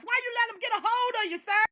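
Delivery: usual speaking voice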